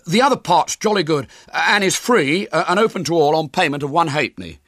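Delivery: high, loud sales patter; big, high-pitched barker's pitch; young showman William. Acted. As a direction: tout the show to the crowd. William advertises rest of show.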